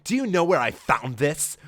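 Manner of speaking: hammed-up accusing hero; mock Sonic voice. Sonic confronting Amy.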